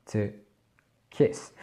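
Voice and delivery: kiss with a short clipped i; To kiss, the i short and quick. Shown as a demonstration. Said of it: "on aura vraiment un i court". (i court dans kiss)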